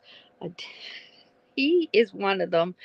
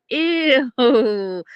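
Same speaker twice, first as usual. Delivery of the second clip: disgusted ew; 6 semitones higher, 6 dB louder than usual. Retold or imitated. Son's reaction quoted.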